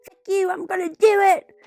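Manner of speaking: high, loud slurred threat; slurred yell. A narrator's reading. Yelling man threatening from the third floor, slurred high yell. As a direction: holler it high, words sliding together. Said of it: "he yelled, his voice slurred".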